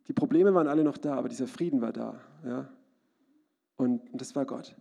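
ordinary talk, nothing marked

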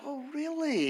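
high, feigned wonder; mock amazement. Preacher feigning interest to the witch, high mock wonder. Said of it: "I said".

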